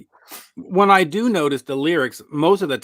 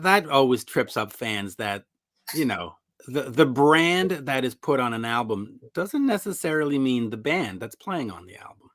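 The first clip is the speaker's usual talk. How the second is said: usual speaking voice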